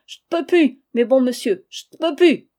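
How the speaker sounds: loud, high wailing cry. Acted. Old woman cries out against the doctors, high loud wail. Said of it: "Elle s'écria".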